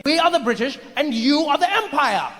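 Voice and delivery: high, emphatic British explanation; hammed-up British superiority; condescending British imperialist. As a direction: spell out the pecking order pompously. British person insists on the distinction.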